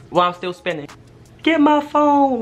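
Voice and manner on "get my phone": high, loud, drawn-out yell; calling out. Retold or imitated. Dizzy speaker calling to family fetching clothes, high drawn-out yell. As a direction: holler it slowly to another room. Calling into other room while spinning.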